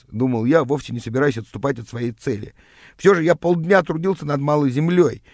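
plain reading voice

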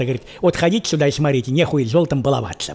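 ordinary talk, nothing marked

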